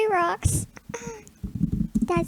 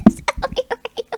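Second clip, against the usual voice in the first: silly whisper; 7 semitones higher, 3 dB louder, faster than usual. Pretend.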